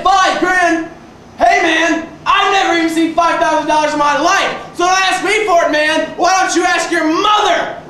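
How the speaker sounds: loud retold yell at the friend. Shouting at drunk friend on the phone, loud retold yell. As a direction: shout it back at him in disbelief. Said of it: "I said, I said".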